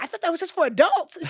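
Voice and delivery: high, loud mock-astonished little girl; amazed child. Niece learning about the birthday song, astonished. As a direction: imitate a kid's loud, high disbelief.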